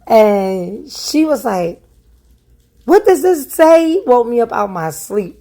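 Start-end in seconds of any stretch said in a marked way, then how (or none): 2.8-4.0 sharp raised mock of mom's demand; angry demand. Mother waking her daughter, demanding answers angrily. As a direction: fire the question high and hard.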